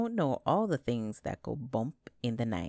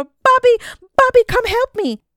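screamed call, much higher and louder; hollering; 17 dB louder than usual. A narrator's reading. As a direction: scream for the dog to save her. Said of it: "she hollered for".

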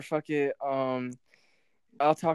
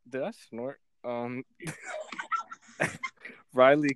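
ordinary talk, nothing marked

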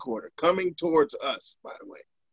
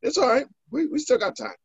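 much higher, soothing reassurance; high mock calm friend; 10 semitones higher than usual. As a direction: pitch it way up, playfully calming. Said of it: "He's like".

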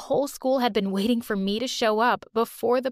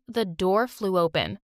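usual speaking voice

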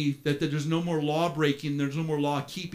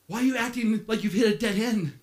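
ordinary talk, nothing marked

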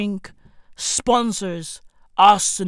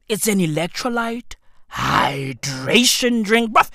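ordinary talk

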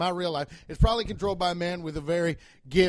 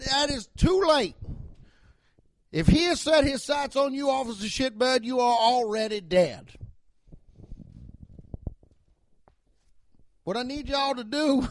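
Southern cop voice; 7 semitones higher than usual. Acted. Cop warns his officers to pray, grave Southern voice. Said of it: "I do that Southern voice".

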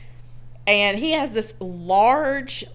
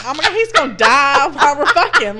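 mock panic; 6 semitones higher, 9 dB louder than usual. Imitating own panic.

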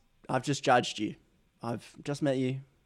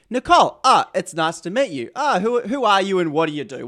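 higher, louder mock-surprised hello; pretend first-meeting greeting; 5 semitones higher, 6 dB louder than usual. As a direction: play running into a new acquaintance.

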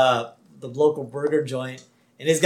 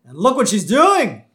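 raised, swooping mock-scandalized voice; Principal Skinner; 6 semitones higher, 6 dB louder than usual. Principal spotting the girl in the lights, hammed-up alarm. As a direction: point her out in loud cartoon dismay. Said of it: "Principal Skinner's there".

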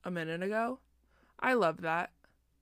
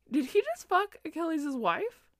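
high loud disbelief on just; shocked disbelief; 9 semitones higher, 5 dB louder than usual. Retold or imitated. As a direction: cry it out, stunned. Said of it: "taken aback".

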